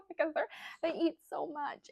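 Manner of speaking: talking through a laugh, high and loud. Real, not acted. Mother recalling how much newborns eat, laughing.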